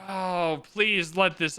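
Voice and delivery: ordinary delivery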